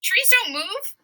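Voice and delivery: a squeaky, over-confident king brushing it off; cocky Macbeth. Retold or imitated. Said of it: "And he's like".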